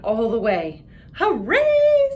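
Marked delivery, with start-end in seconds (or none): 1.1-2.1 high, loud cheer; excited girl cheering on her way to school. Acted. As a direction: burst out with joy.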